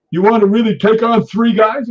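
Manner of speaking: loud, high, taunting question; retold challenge. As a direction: imitate a man daring you to fight. Acted drunken challenge.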